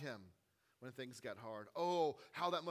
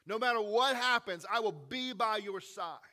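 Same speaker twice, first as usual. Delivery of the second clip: loud, fast, cocky promise; overconfident Peter; 7 dB louder than usual. Retold or imitated. Overconfident Peter swearing loyalty to Jesus, loud and cocky. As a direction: swagger through the vow. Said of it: "Peter being the overconfident guy".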